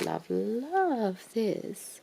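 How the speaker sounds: slow. Real, not acted. Drawn-out love.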